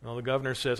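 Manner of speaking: usual speaking voice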